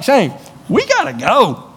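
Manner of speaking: lightly voiced teammate urging him to go; impatient teammate.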